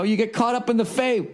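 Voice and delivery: ordinary delivery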